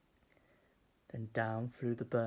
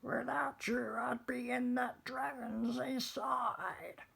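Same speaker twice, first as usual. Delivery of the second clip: high, relieved witch voice; 12 semitones higher than usual. Acted. Witch telling friends they saved her, high relief.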